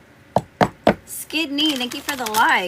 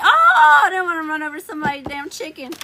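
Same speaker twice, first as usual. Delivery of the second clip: mock whine; 5 semitones higher, 6 dB louder than usual. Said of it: "I'm like".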